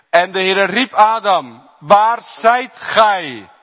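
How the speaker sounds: high, loud, slow call; God calls Adam, slow and booming. Acted. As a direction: call out across a great distance. God calling Adam in scripture.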